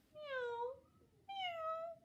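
high thin kitten mewing; tiny kitten meow. A narrator's reading. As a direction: mew like a small kitten. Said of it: "She could only go".

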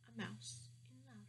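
ordinary delivery, nothing marked

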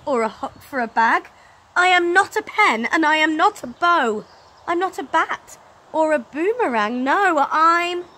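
loud, high rising protest; indignant Stickman. Acted. Stickman protesting to the dad, loud and high. Stickman's angry list of objects.

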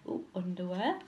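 usual speaking voice

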